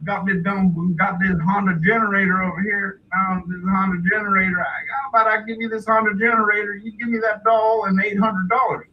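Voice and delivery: high, rapid trader's pitch; hillbilly father. Retold or imitated. Dad trades a Honda generator, hillbilly haggling. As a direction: rattle off a swap like a rube. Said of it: "his dad's like".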